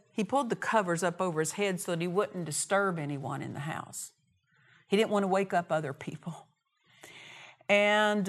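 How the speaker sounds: ordinary talk, nothing marked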